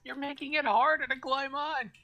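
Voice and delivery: very high, loud protesting yell; high-pitched, exasperated in-character shout; shouted complaint. Acted. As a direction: holler it up while struggling to climb. Climber shouting up at swerving ship.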